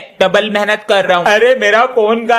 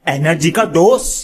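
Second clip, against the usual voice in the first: punchy ad-style tagline with a rising end; jingle-style product pitch. Acted. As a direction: sell it like a TV commercial.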